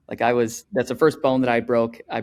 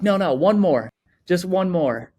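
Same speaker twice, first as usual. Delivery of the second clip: higher, insistent plea for one more run; eager pleading; 6 semitones higher, 3 dB louder than usual. Retold or imitated. At the skate park, begging his brother for one more run, eager and higher. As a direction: beg the brother for one last go.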